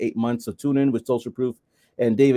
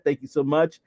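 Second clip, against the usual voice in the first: ordinary talk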